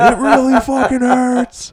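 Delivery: high, loud, slow mock howl of pain; imitating father's yell. Imitating his father slipping on icy stairs, high mock yelp. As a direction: ham up a hurt old man's yelping. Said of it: "That's what he was yelling".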